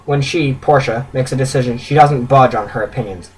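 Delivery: usual speaking voice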